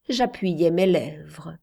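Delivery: ordinary delivery, nothing marked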